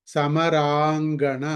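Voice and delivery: సమరాంగణ drawn out very slowly; teacher reading an example word. Shown as a demonstration. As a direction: read సమరాంగణ syllable by syllable. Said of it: "సులభంగా చదువుకోవడానికి".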